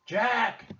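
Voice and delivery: put-on high, loud girl's call; piercingly high holler; crying out. A narrator's reading. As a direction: cry out the name to be heard from afar. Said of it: "came a cry".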